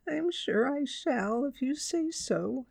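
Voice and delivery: slow, high little bear; nervous young bear. A narrator's reading. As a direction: answer politely but unsure. Nervous Paddington answers Judy.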